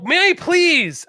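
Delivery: high, drawn-out lesson in manners; exasperated prompting. Acted. Prompting rude customers to use manners, high and exasperated.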